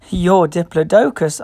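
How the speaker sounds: ordinary delivery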